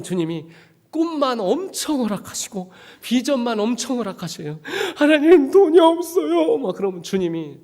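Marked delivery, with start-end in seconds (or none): 5.0-6.6 much higher and louder, slowed and whiny; desperate plea. Retold or imitated. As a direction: beg God like a broke kid. Pleading to God.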